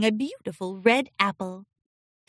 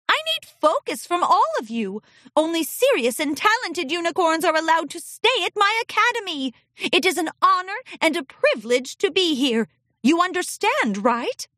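higher, strict teacher voice; stern headmistress; 7 semitones higher than usual. Acted. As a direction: lay down the rules firmly. Headmistress lecturing pupil.

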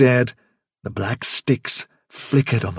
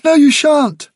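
high, loud pleading protest; desperate cry; 8 dB louder than usual. Acted. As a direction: shout it in desperation. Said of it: "cried Fiver".

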